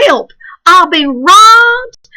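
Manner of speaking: high, loud slow cry for help; Grandpa crying out. Acted. Grandpa calls out that he's been robbed, loud cry. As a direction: wail it like an old man robbed. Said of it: "we heard Grandpa shine".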